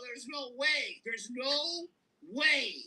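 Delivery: rising disbelief. Retold or imitated. Disbelief at finding the comic.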